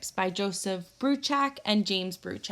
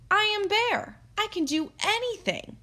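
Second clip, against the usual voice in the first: proud boastful Bear; 12 semitones higher than usual. Acted. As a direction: puff yourself up and boast. Bear stands tall, boasts.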